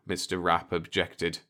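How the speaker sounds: ordinary delivery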